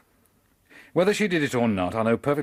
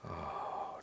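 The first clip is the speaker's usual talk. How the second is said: breathless whisper of dismay; a stunned, unvoiced damn; whispered curse; 10 semitones lower, 16 dB quieter, much slower than usual. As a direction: curse under the breath in shock. Finds prisoner dead.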